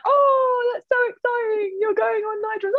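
high, excited gushing impression of a friend; high gushing mock friend voice; imitating James. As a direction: squeal it like an overexcited mate. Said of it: "But me talks like this".

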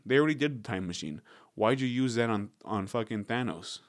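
normal talking voice

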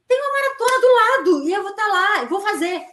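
high, eager mock of her past self; naive excitement. She replays telling her husband about a race next door, high and giddy. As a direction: squeal it like it's the easiest thing.

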